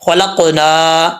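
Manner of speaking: خلقنا with the final نا held one alif long, slowly. Shown as a demonstration. Teacher showing students the long alif, slow. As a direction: stretch the نا in خلقنا. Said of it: "ایک الف کی مقدار لمبا کریں گے".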